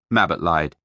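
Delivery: ordinary delivery, nothing marked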